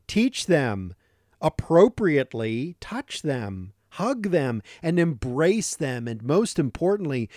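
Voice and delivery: usual speaking voice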